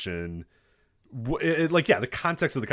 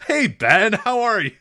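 high, loud, laughing fake-friendly hello; mock chipper greeting; 10 semitones higher, 9 dB louder, slower than usual. Greeting a giant NBA player, high chirpy mock hello. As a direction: chirp it like a nervous fan.

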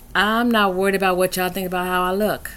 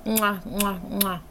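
big wet smooch; 3 semitones higher, 6 dB quieter, much slower than usual. Real, not acted. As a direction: blow big smacking kisses. Blowing kisses at sign-off.

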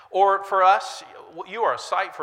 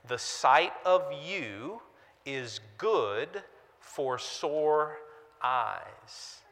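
put-on old quote drawn out; much slower than usual. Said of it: "was the statement".

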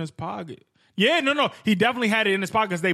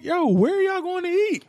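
high-pitched, drawn-out incredulous question; 10 semitones higher than usual. Retold or imitated. Teasing a friend about his younger date, incredulous. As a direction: stretch the question out high, needling a buddy.

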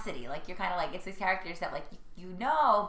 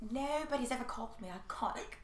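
soft, higher-pitched British actress impression; British accent; 4 semitones higher, 7 dB quieter than usual. British actress answers two fans who called her iconic, in an imitated British accent. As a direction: put on a British accent, hushed and raised. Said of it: "'cause that accent was everything".

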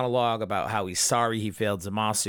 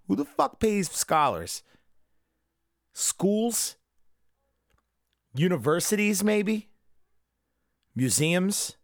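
puzzled high questions; 9 semitones higher, slower than usual. Real, not acted.

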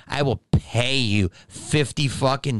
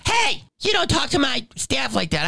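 much higher mock reprimand; indignant scolding; 10 semitones higher than usual. Staffer behind the counter defending his staff, indignantly. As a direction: imitate an outraged little boss. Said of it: "He talked to me like that".

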